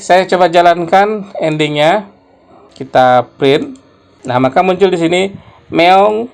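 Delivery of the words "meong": high, louder mock meow; cat meow. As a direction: open the cat's line with a mew. Cat speaks its printed line. (meongan kucing yang tinggi dan keras)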